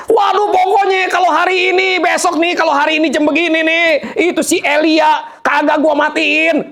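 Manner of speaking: high, shouted angry queen; furious threat. Acted. Jezebel threatening to kill Elijah. (ancaman marah yang diteriakkan)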